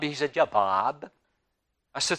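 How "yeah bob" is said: put-on Massachusetts accent. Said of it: "Y'all have an accent".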